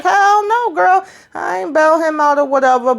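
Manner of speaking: high-pitched, mock-offended "hell no"; indignant denial. Retold indignant denial of bailing out.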